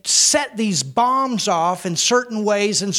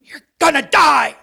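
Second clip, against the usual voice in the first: devil threat; 3 semitones higher, 5 dB louder than usual. Acted.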